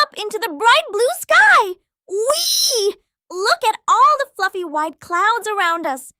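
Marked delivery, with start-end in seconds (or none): 2.1-3.0 whee. Pretend. Flying through sky.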